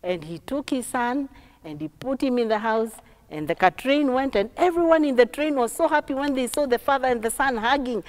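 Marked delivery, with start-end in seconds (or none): none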